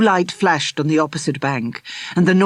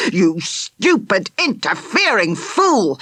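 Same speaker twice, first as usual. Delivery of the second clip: angry; 4 semitones higher, slower than usual. Acted. Furious rescuer.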